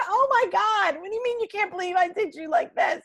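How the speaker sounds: can't believe what you're hearing, voice shooting up; incredulous outburst. Retold or imitated. Officer answering the woman who threatened her, incredulous.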